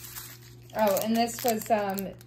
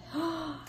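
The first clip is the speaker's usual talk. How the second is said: soft gasp; 6 semitones higher, 7 dB quieter than usual. Real, not acted. Remembers forgotten special piece.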